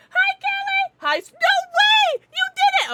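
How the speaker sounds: very high, loud delighted greeting; thrilled squeal. Real, not acted. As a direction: shriek with joy at the news.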